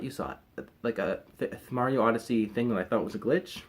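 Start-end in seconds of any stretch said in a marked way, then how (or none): 0.0-0.7 quiet. Acted. Same words, dropped low.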